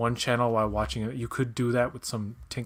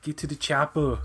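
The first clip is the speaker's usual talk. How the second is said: Schwarzenegger impression; 4 semitones higher than usual. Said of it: "Arnold Schwarzenegger is in Star Trek".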